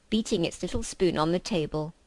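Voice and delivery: plain reading voice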